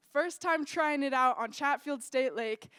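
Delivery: usual speaking voice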